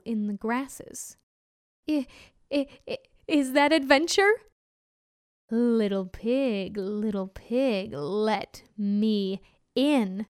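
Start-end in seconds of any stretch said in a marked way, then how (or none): 1.9-4.3 louder frightened stammer; nervous stammer. Acted. Pig hears rustle, stammers. 5.5-10.2 slow, heavy wolf voice; menacing hungry wolf, drawn out. Acted. Wolf voice in story.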